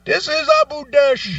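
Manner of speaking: high, loud, sing-song greeting; mock phone agent. Second agent picking up the call, high sing-song mock greeting. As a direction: announce yourself like a helpline rep.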